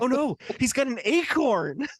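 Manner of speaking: high-pitched pretend alarm; mock panic. Joking with friend over acorn scene, mock panic. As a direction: cry out in hammed-up fright.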